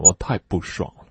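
ordinary delivery

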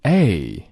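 A glided from open a to e; a clear long gliding A; diphthong model. Shown as a demonstration. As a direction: slide the mouth from a to e on A. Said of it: "来跟着[name]一起朗读。".

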